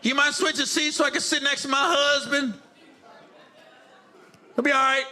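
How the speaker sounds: high, put-on passenger asking a favor; guilt-tripping passenger. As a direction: ask in a high, imploring mock voice. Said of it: "they give you a guilt trip".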